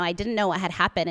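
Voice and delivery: usual speaking voice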